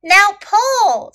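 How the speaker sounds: high, loud urging call. A narrator's reading. Snake urging little elephant to pull, calling out. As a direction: call the command out to urge someone on. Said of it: "Snake called to little elephant".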